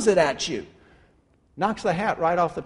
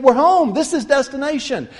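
louder, higher declaration; smug claim; 5 semitones higher, 6 dB louder than usual. Acted. The crowd at the signpost, loudly claiming home. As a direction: proclaim you've arrived.

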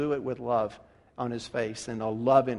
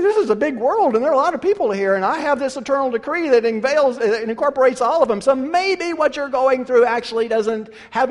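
higher, louder, insistent voice of God; God answering; 7 semitones higher, 7 dB louder than usual. Acted. God answering the complainer, insistent and emphatic. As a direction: reason with a complaining child, firmly. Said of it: "And the voice comes from heaven".

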